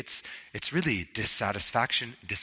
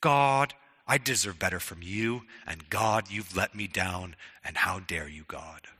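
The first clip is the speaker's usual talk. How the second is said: resentful prayer; 7 semitones lower, 4 dB quieter than usual. Retold or imitated. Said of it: "really what we're saying is".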